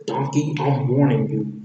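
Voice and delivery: loud, firm warning; put-on ogre voice, threatening. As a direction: warn them off, forcefully. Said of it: "in a threatening way".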